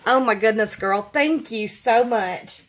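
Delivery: ordinary delivery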